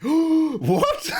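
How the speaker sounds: high gasping what, laughing through it; shocked gasp. Real, not acted. Streamer hears he made the Daily Mail, gasps high in shock. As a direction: gasp in disbelief.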